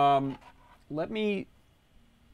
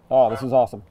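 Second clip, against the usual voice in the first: excited; 7 dB louder, much faster than usual. Real, not acted. Mask honks, delighted reaction.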